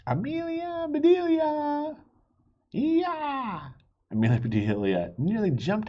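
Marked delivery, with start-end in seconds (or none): 0.1-3.7 high, drawn-out call; waitress calling across the diner. A narrator's reading. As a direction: sing out her name to startle her. Doris calls out suddenly.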